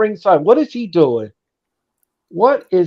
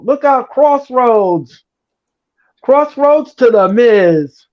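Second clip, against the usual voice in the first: drawn-out finisher call; slow dramatic call; 3 semitones higher, slower than usual. Acted. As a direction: stretch the call out slowly.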